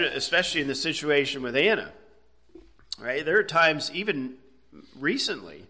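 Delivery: usual speaking voice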